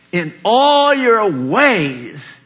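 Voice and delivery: slow and drawn out, all lifted high and loud; a slow, ringing "In all your ways" with all held longest; stress on all. Acted. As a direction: lean hard on all and stretch every word. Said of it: "It didn't say in some of your ways".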